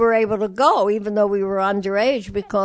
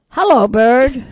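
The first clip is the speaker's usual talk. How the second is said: louder, lightly imitating a parakeet; 3 semitones higher, 8 dB louder than usual. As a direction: chirp it like a talking bird. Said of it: "He said".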